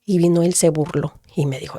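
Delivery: ordinary talk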